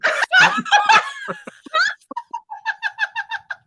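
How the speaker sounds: high loud burst of laughter; shrieking laugh. Real, not acted. Cracking up at cohost's joke, shrieking laugh. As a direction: let out a screaming cackle.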